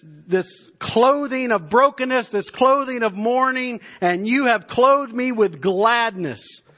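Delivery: high, loud, ringing proclamation of the psalm; soaring preacher's cry; full-force preaching. Acted. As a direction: lift the psalm up like a call to worship. Preaching builds to climax.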